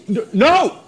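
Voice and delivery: high, loud, alarmed cry; panicked shout. Acted. Waiter tries to stop diner improvising, panicked shout.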